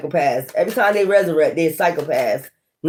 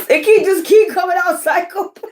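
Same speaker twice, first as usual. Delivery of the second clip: much higher, squealed through giggles; laughing; 11 semitones higher than usual. Real, not acted. Tarot reader repeating the card's word, squealing with laughter. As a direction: say it while cracking up in disbelief.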